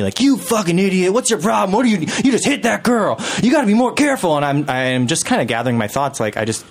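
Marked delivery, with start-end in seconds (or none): 0.2-4.4 high-pitched mock rant; re-enacted furious telling-off; imitated angry screaming. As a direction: scold like an irate stranger, pitched way up. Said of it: "this guy's just screaming at me".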